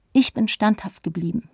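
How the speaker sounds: ordinary delivery, nothing marked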